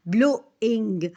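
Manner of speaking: ब्लोइंग split as ब्लो - इंग; two separate syllables in ब्लोइंग; broken in two beats. Shown as a demonstration. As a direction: say ब्लोइंग with a clear break before इंग. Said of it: "अपनी sound को break किया".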